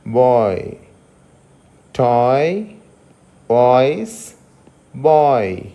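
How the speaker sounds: "వాయిస్" and "బాయ్" gliding o to i; oi diphthong demo. Shown as a demonstration. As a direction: repeat "బాయ్" slowly, sliding o into i. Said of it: "ఈ sound ఉంటుంది". ("వాయిస్", "బాయ్" లో ఓయ్ ధ్వని జారుతూ)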